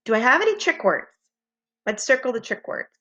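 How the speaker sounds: usual speaking voice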